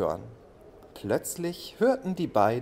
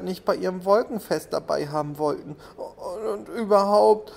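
tearful cloud; 7 semitones higher than usual. A narrator's reading. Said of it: "fing nun richtig doll an zu weinen".

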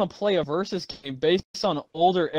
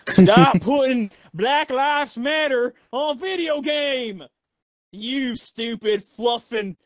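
high, slow Southern country drawl; 7 semitones higher, slower than usual. Pretend. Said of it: "I'll do my good country voice this time".

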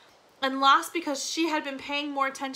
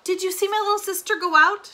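high, loud, urgent question; frantic questioning; 8 semitones higher, 8 dB louder than usual. A narrator's reading. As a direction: ask urgently for help finding a lost child. Frantic search for missing sister.

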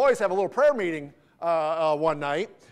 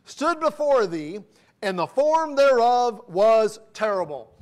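put-on slow, raised, solemn reading of old scripture; 3 semitones higher, 3 dB louder, slower than usual. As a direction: read each phrase slowly and grandly, like a pulpit reading. Reads King James scripture aloud.

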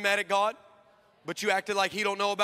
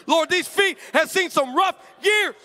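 mock prayer; 8 semitones higher than usual. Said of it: "that's how I started the prayer of faith".

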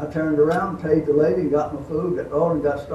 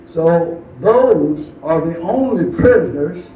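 ordinary talk, nothing marked

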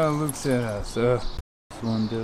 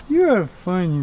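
amused; 8 semitones higher, 10 dB louder than usual. Real, not acted. Laughing at dog.

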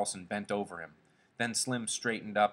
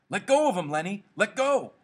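high, loud, desperate command; urgent shout; 11 semitones higher, 8 dB louder than usual. Acted. George tries to make Lenny release Curly, shouting. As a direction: shout him off, pitch leaping up.

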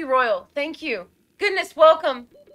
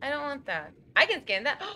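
ordinary delivery

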